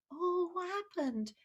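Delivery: a parent gently asking what happened; a soft, caring question; gentle parent. Acted. Parent asks the child.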